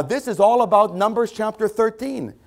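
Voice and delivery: ordinary talk, nothing marked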